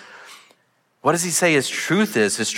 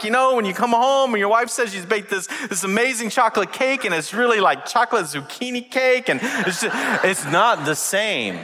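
ordinary talk, nothing marked

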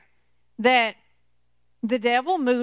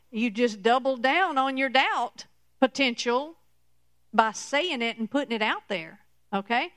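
ordinary talk

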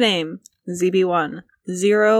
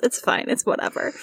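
normal talking voice

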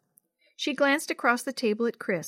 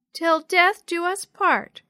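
put-on high, loud, slow child voice; reluctant little sister; 8 semitones higher, 7 dB louder, slower than usual. A narrator's reading. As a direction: drag the vow out like a small child. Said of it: "Yo-Yo muttered, not liking the sound".

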